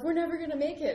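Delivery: high, loud despair; panicked thought. Retold or imitated. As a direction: think it in panic. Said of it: "I'm thinking to myself".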